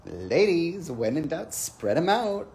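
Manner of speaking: rally the ladies like a bossy friend. Retold or imitated. Lily calling to the ladies, lightly bossy. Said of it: "she's like".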